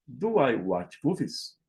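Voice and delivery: usual speaking voice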